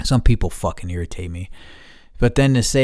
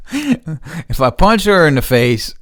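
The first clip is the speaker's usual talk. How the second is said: higher, louder, slow if I punch her in the face; emphatic; 6 semitones higher, 5 dB louder, slower than usual. Real, not acted. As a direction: lean hard on it, deadly serious. Repeats it seriously.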